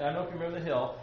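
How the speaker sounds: usual speaking voice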